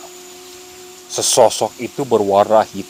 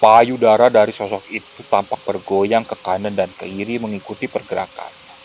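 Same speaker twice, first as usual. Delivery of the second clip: ordinary delivery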